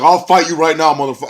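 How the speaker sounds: loud, high challenge; angry shout. Retold or imitated. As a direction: snarl the challenge. Bennett cussing back.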